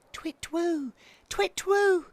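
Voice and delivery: high, restrained twit-twoo hoot; tentative baby owl hooting; quiet owl hoot. A narrator's reading. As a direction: practise a high little hoot. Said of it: "he hooted quietly at first".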